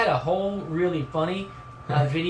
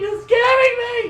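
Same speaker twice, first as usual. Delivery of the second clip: very high mock-scared squeal; hammed-up frightened yelp; mock fright; 4 dB louder than usual. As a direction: squeal as if spooked. Mock fright at co-host's picture.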